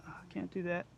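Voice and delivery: pained, breathless, cramping leg; strained by a cramp. Real, not acted. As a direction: hold it through a leg cramp. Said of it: "Leg is cramping up".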